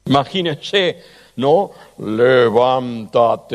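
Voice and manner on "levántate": slow, drawn-out, solemn command; slow solemn "Levántate", voice of God. Shown as a demonstration. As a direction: intone it like God calling Lazarus. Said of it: "Y pongo otra voz".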